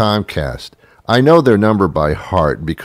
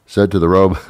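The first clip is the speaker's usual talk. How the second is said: ordinary delivery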